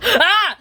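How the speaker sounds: high, loud "ah"; acted scream. Child's character cries out after 'almost', high loud scream. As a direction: burst into a sudden high cry.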